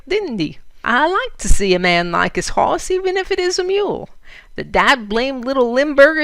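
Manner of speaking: loud, high good-humoured Western outlaw. Acted. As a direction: boom it out like a jolly bandit. Outlaw laughing about the Dutchman.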